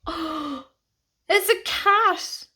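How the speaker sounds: thrilled at spotting a cat; delighted surprise. Real, not acted. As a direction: squeal of delight, drawn out.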